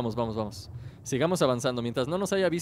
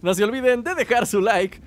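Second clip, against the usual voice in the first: youtuber outro; 3 semitones higher, 3 dB louder than usual. Acted. Channel outro.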